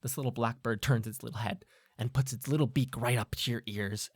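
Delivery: ordinary delivery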